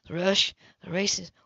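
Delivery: ordinary delivery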